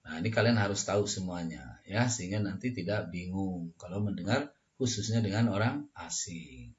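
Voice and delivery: ordinary talk, nothing marked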